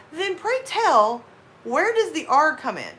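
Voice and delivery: high, slow, mock-posh question; mock formal boyfriend. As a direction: ham it up like a pedantic gentleman. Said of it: "He's like".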